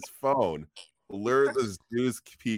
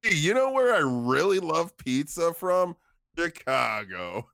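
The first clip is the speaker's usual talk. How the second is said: mock New Yorker cop; 7 semitones higher than usual. Police baiting a New Yorker, mild New Yorker. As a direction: tease about pizza like a New Yorker. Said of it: "are like".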